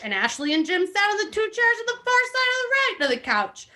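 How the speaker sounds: reading a memoir aloud with mock gravity; lightly hammed-up reading of a memoir; dramatic book reading. Host reads Ed Russo's book to friends, mock-dramatic reading.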